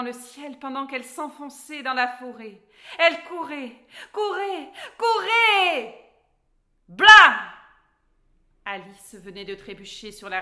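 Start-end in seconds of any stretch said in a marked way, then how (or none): 3.0-6.1 rising louder and higher each repeat; building excitement. A narrator's reading. Running after the phoenix. 6.9-7.3 shouted crash sound effect; loud crash. A narrator's reading. Alice trips.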